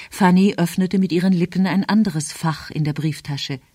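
usual speaking voice

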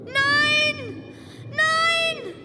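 put-on boy shrieking no in a nightmare; terrified scream. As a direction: scream in terror at the knife.

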